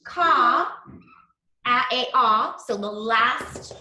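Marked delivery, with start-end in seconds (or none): 0.0-2.6 "ka, a, a, ah" split into separate drawn-out sounds; teacher modelling each sound for the class; segmented sounds. Shown as a demonstration. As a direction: sound out "ka" then "a, a, ah" one at a time. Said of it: "so we say".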